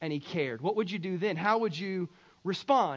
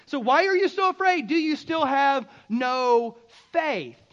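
loud rebuke; 3 semitones higher, 6 dB louder than usual. Acted. Jesus to his frightened disciples, slowing loud rebuke. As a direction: start high and loud, slow right down.

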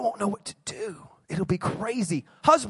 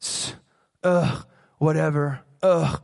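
drawled, low sulky "ugh, whatever"; bored teen groan; 7 semitones lower, slower than usual. Retold or imitated. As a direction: sulk like a teen being lectured. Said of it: "you're like".

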